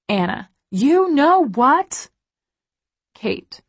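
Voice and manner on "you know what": high, slow teasing tune; drawn-out playful tease; teasing sing-song. A narrator's reading. Chat reading, teasing.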